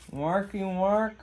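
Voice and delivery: talking around a marker held in the mouth; words muffled by a marker between the lips. Real, not acted. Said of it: "talking with the marker in my mouth".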